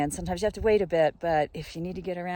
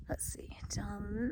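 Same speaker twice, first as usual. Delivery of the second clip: whispering; 9 dB quieter, much slower than usual. Real, not acted. Looking down from the top.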